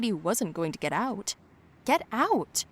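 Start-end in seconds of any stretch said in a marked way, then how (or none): 1.8-2.6 higher, forceful command; angry order. A narrator's reading. Girl orders Colin out of her car, angry. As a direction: order them out, exasperated. Narrator acts quarrel in car.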